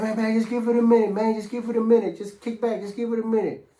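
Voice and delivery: quick, high-pitched urging to wait; placating buddy. Retold or imitated. Inmate telling his impatient friend to hold on, placating. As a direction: imitate a friend hurriedly talking someone down.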